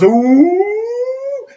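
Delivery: very high, teasing ooh from the class; hooting ooh of kids at a classmate; mocking classroom ooh. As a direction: tease like the whole class sneering. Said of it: "And everyone goes".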